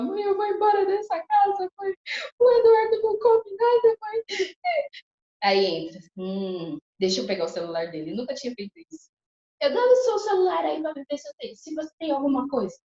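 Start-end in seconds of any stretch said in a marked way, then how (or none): 0.0-4.2 high, loud sobbing complaint; crying to mom. Retold or imitated. Wife crying to her mother about the husband, tearful wail. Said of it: "E chorava, falava". 9.6-12.8 high, loud insistent demand. Retold or imitated. Wife demanding her husband's phone, loud and high. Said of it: "demanding husband's phone".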